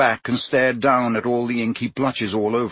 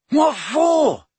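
high, louder disbelieving demand; can't believe he'd save it; incredulous demand; 4 dB louder than usual. Acted. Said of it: "demanded Ron incredulously".

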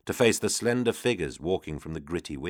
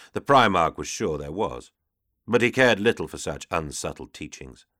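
usual speaking voice

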